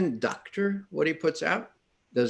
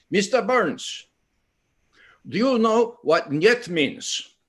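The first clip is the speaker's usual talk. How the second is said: mock stern Russian official; 8 semitones higher, 3 dB louder, slower than usual. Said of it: "Burns goes in and he says".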